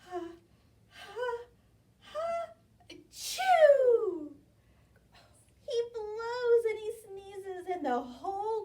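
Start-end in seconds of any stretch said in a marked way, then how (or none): 0.1-4.3 rising ha-ha-ha into a loud chew; hammed-up storybook sneeze; big sneeze. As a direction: build the sneeze then burst. Bear's sneeze.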